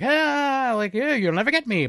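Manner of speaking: high, mocking taunt; taunting invisible man. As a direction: tease them like a gleeful prankster. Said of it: "waving his arms like".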